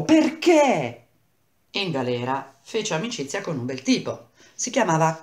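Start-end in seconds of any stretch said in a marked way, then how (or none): 0.0-1.1 high, loud dramatic lament; anguished cry. Acted. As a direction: cry out in theatrical dismay. Narrator laments friend's abandonment.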